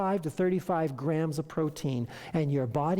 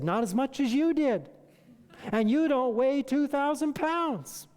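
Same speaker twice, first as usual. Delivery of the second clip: higher-pitched, pointed comeback; emphatic retort; 8 semitones higher than usual. Retold or imitated. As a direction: drive the point home with a raised voice. Doctor's emphatic retort retold.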